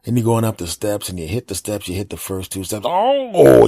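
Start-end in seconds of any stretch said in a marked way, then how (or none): 2.9-3.7 high, loud yelp of pain; sudden pain yelp. Retold or imitated. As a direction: yelp as the back catches you. Back pain on the stairs.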